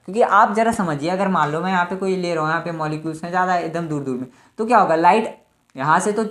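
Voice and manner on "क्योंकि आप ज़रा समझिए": ordinary delivery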